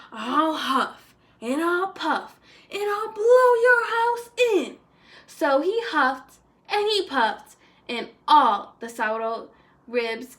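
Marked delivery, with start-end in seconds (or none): 0.1-4.8 high, loud and slow gloating threat; big bad coyote threat. Coyote threatening the javelinas' house, loud slow menace. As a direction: draw out the threat like a hungry villain.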